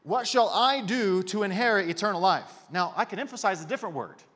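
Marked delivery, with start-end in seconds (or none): 0.0-2.6 loud full question with "I" punched; stress on I. Shown as a demonstration. Preacher quoting the lawyer who trusts his deeds, loud stress on "I". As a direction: hit "I" hard, then carry on to the end. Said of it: "can I emphasize a word for you?".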